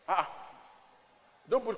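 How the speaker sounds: usual speaking voice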